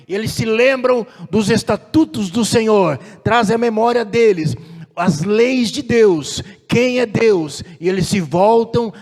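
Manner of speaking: fervent sermon delivery; preaching at full force. Real, not acted. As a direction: drive each phrase home to the congregation.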